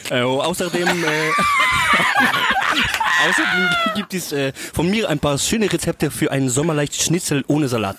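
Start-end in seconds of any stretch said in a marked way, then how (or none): 1.4-3.2 high shrieking laugh; helpless high-pitched laughter; burst of laughter. Real, not acted. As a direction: cracks up in a high squeal.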